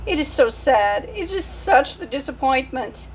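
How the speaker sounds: put-on French-accented theatrical lament; sighing French mother. French mother sighing over her only baby. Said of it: "She sighed".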